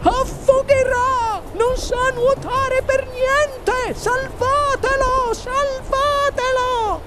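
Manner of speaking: sustained falsetto wail, slow; panicked mother shrieking. A narrator's reading. Mother crying that her son will drown, panicked shriek. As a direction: scream each plea, drawn out. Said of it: "strillò la signora Gloop".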